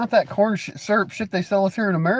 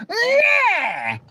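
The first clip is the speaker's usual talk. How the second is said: mock wail. Continues mock crybaby.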